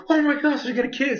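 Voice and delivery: high, excited squeal of hope; excited gasp. Retold or imitated. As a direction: gush like a fan seeing the kiss coming. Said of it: "and I was like".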